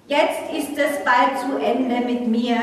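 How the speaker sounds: normal talking voice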